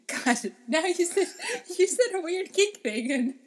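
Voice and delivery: high, loud laughing; cracking up. Real, not acted. Laughing at partner's joke.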